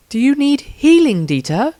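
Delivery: high, gentle healer asking; young woman voice. A narrator's reading.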